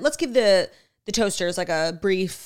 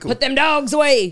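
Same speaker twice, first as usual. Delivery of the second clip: mock folksy voice; 5 semitones higher, 6 dB louder than usual. Mocking the odd phrase.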